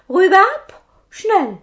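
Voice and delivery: a little man demanding heads, high and loud; tiny captain's order. Acted. Robber captain orders the pilgrims beheaded, squeaky and loud.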